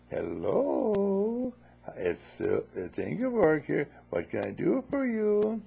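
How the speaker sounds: high, seductive phone voice; sexy voice. Retold or imitated. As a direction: purr it to tempt the caller. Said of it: "Sexy voice".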